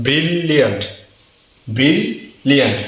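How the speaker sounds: ব্রিলিয়ান্ট as drawn-out bril, then liant; lengthened pronunciation. Shown as a demonstration. Teacher repeating the word for learners, lengthened bril-liant. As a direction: hold bril in ব্রিলিয়ান্ট before the second L. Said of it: "একটু দেরি করে".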